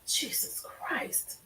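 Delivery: slow, breathless whisper of shock. Real, not acted. Host stunned by testimony, whispering.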